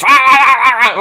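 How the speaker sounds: loud, repeated "ah" bursts; loud mock babble. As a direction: blurt out a run of loud ahs. Mocking outburst of noise.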